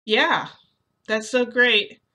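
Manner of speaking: high, loud, overdone agreement; forced enthusiasm. Acted. Narrator answers Midoriya while panicking inside, forced enthusiasm. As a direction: cover panic with a too-keen reply.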